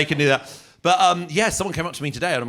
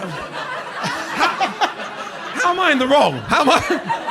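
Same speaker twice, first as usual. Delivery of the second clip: much higher, louder incredulous squeak; exasperated protest; 14 semitones higher, 5 dB louder, much slower than usual. Retold or imitated. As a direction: squeal it in disbelief. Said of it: "And you go".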